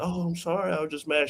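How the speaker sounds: put-on girlfriend's apology.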